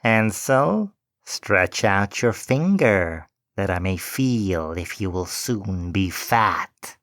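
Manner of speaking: slow, sly witch voice calling into the stable; lightly voiced greedy witch. A narrator's reading. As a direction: coax him to show his finger. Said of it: "and cried".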